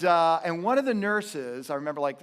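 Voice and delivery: ordinary delivery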